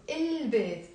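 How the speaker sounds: البيت with its L clearly sounded; pronounced L. Shown as a demonstration. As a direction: hold the L before the B in البيت. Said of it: "we do pronounce the l".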